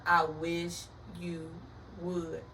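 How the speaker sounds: slow, word-by-word warning; slow, deliberate daring repeat; drawn-out dare. Retold or imitated. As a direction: stretch each word with a pause before the last.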